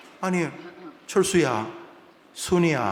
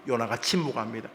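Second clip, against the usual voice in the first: usual speaking voice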